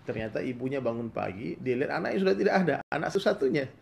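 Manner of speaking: usual speaking voice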